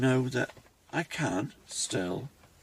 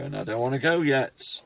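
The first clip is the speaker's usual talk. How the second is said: louder, voice welling with real emotion; getting emotional; 4 semitones higher, 11 dB louder than usual. Real, not acted. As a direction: emotion rising on the last words. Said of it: "sorry, I'm getting a bit emotional".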